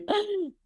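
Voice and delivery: sharp high gasping inhale; quick startled intake of breath; demo gasp. Shown as a demonstration. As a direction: gasp in like you're about to blow a balloon. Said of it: "by going like that".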